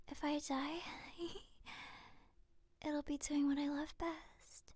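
intimate breathy whisper. Acted. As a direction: whisper it close to the ear.

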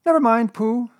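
high, loud, impatient little voice; impatient Piglet. A narrator's reading. Piglet brushing Pooh off over the question, impatient. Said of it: "said Piglet impatiently".

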